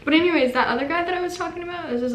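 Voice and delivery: ordinary talk, nothing marked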